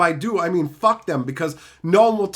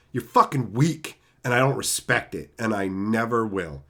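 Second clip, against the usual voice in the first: 1 contemptuous; 8 semitones lower than usual. Real, not acted. Contemptuous lowering of voice.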